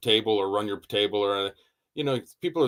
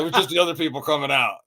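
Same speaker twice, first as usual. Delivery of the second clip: lightly voiced other maker; 5 semitones higher, faster than usual. Voicing Les Robertson.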